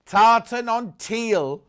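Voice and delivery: an accidental jokey Scottish-ish lilt; funny half-Scottish voice. Pretend. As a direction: stretch it out in a silly mock accent. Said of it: "that funny voice then".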